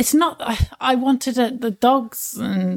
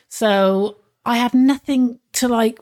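usual speaking voice

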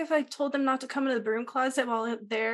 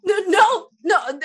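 panicked refusal; 7 semitones higher, 7 dB louder, slower than usual. Acted. Panicked refusal of more games.

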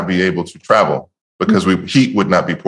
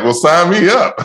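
much higher, eager mock exclamation; eager acceptance; 11 semitones higher than usual. Answering the musician's coaching offer, high-pitched eager reenactment.

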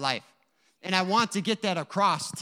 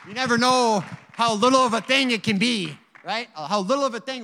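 preaching loud; 6 semitones higher, 9 dB louder than usual. Real, not acted. Preacher driving home a point.

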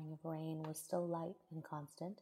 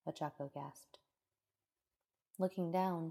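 plain reading voice

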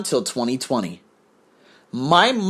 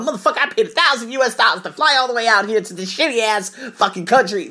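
higher-pitched mock tirade; imagined angry rant; 6 semitones higher than usual. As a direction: rant as if you were fed up, half in earnest. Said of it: "you know how angry I would be".

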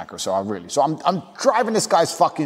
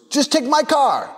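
very high, drawn-out offer; old party guest; 13 semitones higher, slower than usual. Retold or imitated. Old guy offering his car to a guest, high put-on voice. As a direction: sing out the offer in an imitated voice. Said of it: "some old guy comes up".

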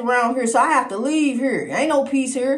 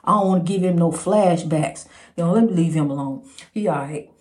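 much lower, hushed-down voice; toned down; 8 semitones lower than usual. Real, not acted. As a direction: bring it way down low. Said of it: "I'm toning it down".

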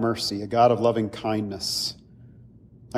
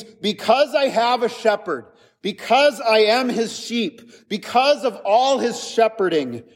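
higher, impassioned proclamation of trust; emphatic declaration; 9 semitones higher than usual. Acted. Preacher voicing the psalmist's certainty, impassioned and high. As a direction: declare it like an unshakable vow. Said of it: "It is this emphatic word".

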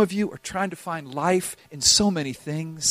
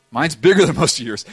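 ordinary talk, nothing marked

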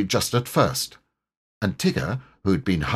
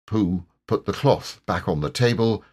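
usual speaking voice

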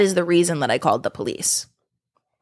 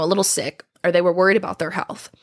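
ordinary talk

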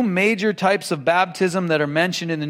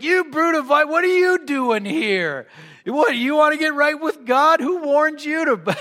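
higher, harsh mock rebuke; mock fierce John the Baptist; 8 semitones higher than usual. John the Baptist scolding the Pharisees with a high, harsh mock rebuke. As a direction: bark it at the crowd like a stern prophet.

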